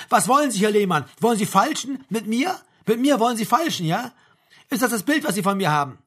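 loud, affronted anger; indignant. A narrator's reading. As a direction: fire incredulous questions at a subordinate. Major angry at haggling, building.

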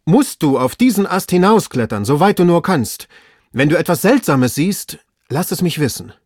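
high, loud, urgent orders; excited master calling instructions upward. A narrator's reading. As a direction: press the order home, agitated. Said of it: "offenbar sehr erregt".

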